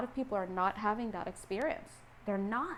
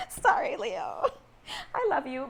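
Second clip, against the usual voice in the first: real giggling, pitched up; laughing apology; 4 semitones higher, 3 dB louder than usual. Real, not acted. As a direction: apologise affectionately through laughter. Laughing apology for teasing Leo.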